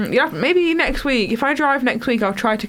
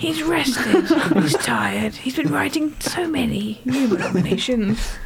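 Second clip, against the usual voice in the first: measured wildlife narrator; David Attenborough; slower than usual. Acted. Co-host describing the producer like wildlife, measured narrator impression. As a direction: narrate slowly, like observing an animal. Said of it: "David Attenborough".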